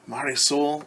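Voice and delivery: plain reading voice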